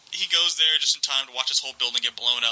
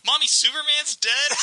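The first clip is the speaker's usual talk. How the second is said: put-on much higher little child asking; kid voice; 13 semitones higher, 3 dB louder than usual. Little kid in line asking his mom, high child voice. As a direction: pipe it up like a small boy. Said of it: "this little kid looks up at his mom and goes".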